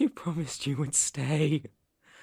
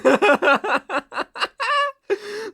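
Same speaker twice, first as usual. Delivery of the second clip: high, loud unhinged laugh; manic cackle; 6 dB louder than usual. Acted. Captor gloats over recaptured rabbit, manic cackle. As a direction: cackle in rising hysteria.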